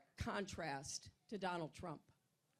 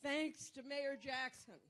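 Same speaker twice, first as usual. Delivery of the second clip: ordinary talk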